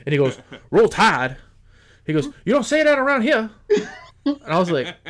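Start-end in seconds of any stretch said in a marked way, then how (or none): 0.7-1.4 loud, indignant imitated Southern drawl; mock New Orleans drawl. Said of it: "The Southern drawl was just out". 2.4-3.5 high, loud, quick mock Southern scolding; indignant imitated New Orleans drawl. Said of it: "The Southern drawl was just out".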